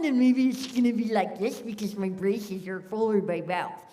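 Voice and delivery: loud voice pushed through braces. Shown as a demonstration. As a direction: talk like your mouth is full of braces. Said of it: "if I've got braces on".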